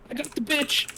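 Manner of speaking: very high, contained holler; mock yell. Player yelling news to Simone, high mock yell. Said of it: "I'm gonna yell to".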